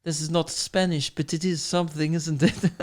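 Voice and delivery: hammed-up foreign accent ending in a laugh; mock Mediterranean accent. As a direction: do a vague, not-quite-Spanish accent. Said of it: "the ones where they use their hands like this".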